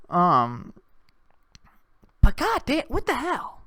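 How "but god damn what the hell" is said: normal talking voice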